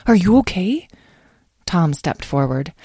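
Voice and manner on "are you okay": put-on high-pitched, tentative child's voice; small worried voice, lifted high; nervous question. A narrator's reading. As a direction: ask timidly if a parent is all right. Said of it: "whispered nervously".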